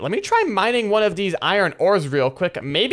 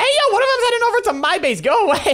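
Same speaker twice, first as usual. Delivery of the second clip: much higher urgent yell at the mob; alarmed yell; 14 semitones higher than usual. Real, not acted. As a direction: shout to chase it away. Mob heading to his base.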